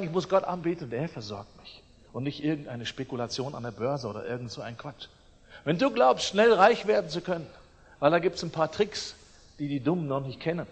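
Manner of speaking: ordinary delivery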